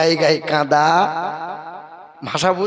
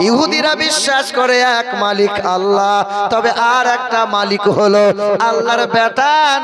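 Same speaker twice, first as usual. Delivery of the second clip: slow, drawn-out sermon chant, louder; preaching; 3 semitones higher, 4 dB louder, slower than usual. Acted. Preacher explaining belief to crowd, chanted. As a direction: intone it to the gathering.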